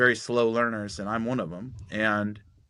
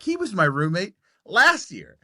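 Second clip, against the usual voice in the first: high and louder, amazed; excited reveal; 8 semitones higher, 5 dB louder than usual. Retold or imitated. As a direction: blurt out the coincidence. Revealing shared roommate.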